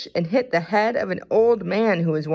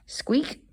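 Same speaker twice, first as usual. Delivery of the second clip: squeak; 8 semitones higher, 4 dB quieter than usual. A narrator's reading. Mouse squeaks in narration.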